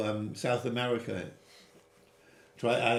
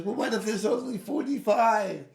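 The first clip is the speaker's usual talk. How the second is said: higher, earnest bargaining plea; pleading Abraham; 8 semitones higher, 3 dB louder than usual. Retold or imitated. Abraham pleading with God to spare the innocent, higher and earnest. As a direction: coax him like a lawyer pressing a case. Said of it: "Abraham says".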